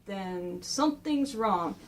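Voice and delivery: slow, soft and low, a villain sensing trouble; ominous quiet line. Acted. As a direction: drop it quiet and slow, like something's off. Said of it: "he's going like".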